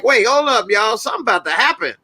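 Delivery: high-pitched mock alarm; acted alarm. Acts out alarm at armed cops.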